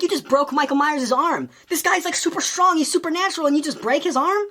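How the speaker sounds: can't believe what you just saw; incredulous. Retold or imitated. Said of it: "And I'm like".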